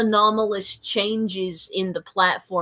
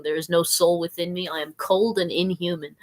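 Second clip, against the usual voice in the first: usual speaking voice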